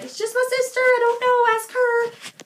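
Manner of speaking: high mock-innocent protest; squeaky defensive reply. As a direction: squeak it out, exasperated. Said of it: "And I was like".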